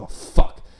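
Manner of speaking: loud, voiceless burst of dismay, imitated; retold snapping out. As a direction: blurt it in a big breathy mock outburst. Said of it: "And then the kid snapped out".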